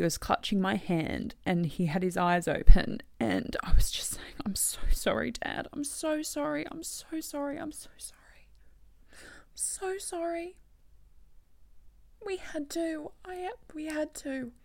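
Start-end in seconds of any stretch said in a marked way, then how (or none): 4.4-10.6 tearful apology. Real, not acted. Apologising to dying dad, tearful and breaking. 12.2-14.5 high, choked-up voice; real tearful distress. Real, not acted. Explaining the choice made for dad, tearful and choked. Overcome while recounting father's death.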